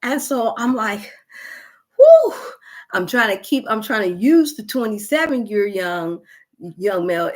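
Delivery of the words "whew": high, loud blown-out whew; loud exhausted whew. Retold or imitated. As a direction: blast the air out, spent. Exhausted during workout.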